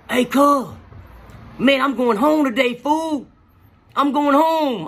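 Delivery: high, loud, thrilled announcing; bursting to share the good news; excited news. Retold or imitated. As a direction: friend shouting that he's going home. Dreamer thrilled about release.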